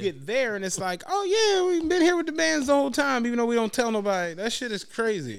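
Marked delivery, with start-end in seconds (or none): 1.1-4.3 high, airy mock of a clueless staffer. Retelling the arena staffer to a friend, high mocking voice. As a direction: ham up the clueless worker at the desk. Staff member mocked in retold story.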